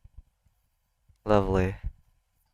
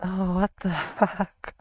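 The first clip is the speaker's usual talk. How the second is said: laughing disbelief; 5 semitones higher, 7 dB quieter than usual. Real, not acted.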